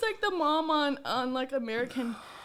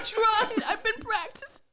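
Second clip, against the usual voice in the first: laughing protest; 9 semitones higher than usual. Real, not acted. Defending her practiced accent to teasing co-hosts, laughing. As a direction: protest while helpless with laughing.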